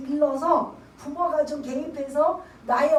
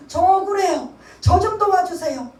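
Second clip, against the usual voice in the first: loud, high crying out in prayer; imitating a wronged believer wailing to God; tearful plea to God; 5 semitones higher, 11 dB louder than usual. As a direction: pour out the grievance like a wronged child. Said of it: "성령님 앞에 우는 거죠".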